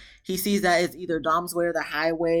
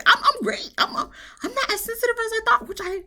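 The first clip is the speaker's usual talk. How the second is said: squeaky joke voice. Real, not acted. Joking self-assessment.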